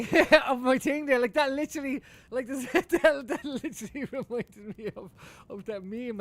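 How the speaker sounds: laughing loudly. Real, not acted. Laughing at a meme moment.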